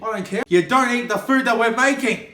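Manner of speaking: loud, high furious scolding; angry telling-off. Acted. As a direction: lay into him, loud and high. Quarrel over eaten pizza.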